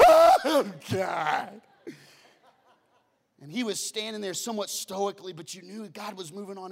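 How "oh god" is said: laughing mock wail; mock sob. Pastor mimicking the weeping wife, half laughing. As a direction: imitate the crying wife while laughing. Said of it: "ugly crying all over the place".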